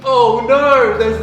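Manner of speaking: loud, high mock cry of dismay; mock alarm. Pranked teacher finding a snake, mock alarm. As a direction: cry out in hammed-up horror.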